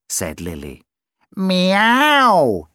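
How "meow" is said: higher, louder drawn-out meow; a long sleepy cat's meow; mewing. Acted. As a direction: mew it like a lazy cat. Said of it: "mewed the cat".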